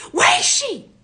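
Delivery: very high, loud yell; frantic shouted demand. Retold or imitated. Robber demands the sister's whereabouts, frantic high shout. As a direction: shriek the question in alarm.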